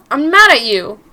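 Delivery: loud, defiant shout; angry shout back. Acted. Mary shouting back at her angry boyfriend. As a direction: throw it back at him.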